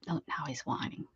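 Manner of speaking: soft unvoiced aside; whisper. Real, not acted. Whispering to viewers about the whining dog, hushed whisper. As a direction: whisper so the dog stays calm.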